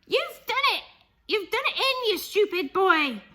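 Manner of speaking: high, loud scolding grandmother; Grandma shouting. Acted. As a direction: tell the boy off furiously. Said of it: "Grandma shouted".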